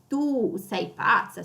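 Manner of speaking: slowly, with the u in tu lengthened; tu long and weighted; stress on tu. Shown as a demonstration. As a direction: stretch the tu. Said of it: "see how I stress the tu".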